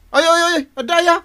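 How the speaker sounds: high startled yelp of a caught husband; caught-out husband's high yelp of alarm; panicked yelp. Acted. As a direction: squeal in alarm at being found out.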